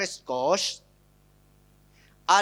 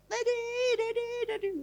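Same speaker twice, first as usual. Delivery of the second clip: put-on child calling out; 6 dB quieter than usual. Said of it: "Anaknya teriak-teriak".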